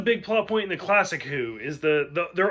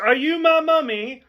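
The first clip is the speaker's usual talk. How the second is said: put-on high, loud creepy child voice; creepy kid; 9 semitones higher, 6 dB louder than usual. Quoting the gas mask kid, high creepy child voice. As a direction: pipe it up like an eerie child. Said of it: "because of the creepy kid".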